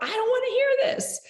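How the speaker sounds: high, loud, whiny refusal; reactive inner voice. Retold or imitated. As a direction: blurt it like a fed-up kid plugging her ears. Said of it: "that's the reactive part of me".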